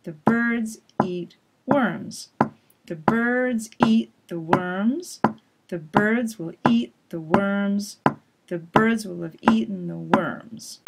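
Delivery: "birds" and "worms" held on the beat, little words squished between; rhythmic stress-timed demo; same beat, squeezed words. Shown as a demonstration. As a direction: keep the beat, cram the function words. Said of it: "said really quickly like this".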